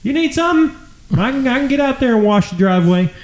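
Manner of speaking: high, hollered comic dad greeting; dad mode. Acted. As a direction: call down the street as a shameless, over-eager dad. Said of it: "I'll go dad mode".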